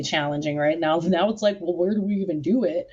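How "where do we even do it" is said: ordinary delivery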